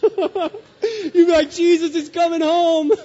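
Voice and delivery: high squealing laugh through the words. Retold or imitated. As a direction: laugh it out, delighted and disbelieving. Preacher laughing at imagined child.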